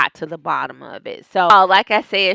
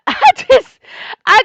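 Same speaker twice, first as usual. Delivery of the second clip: laughing; 6 dB louder than usual. Real, not acted. Laughing at Brooke's bag.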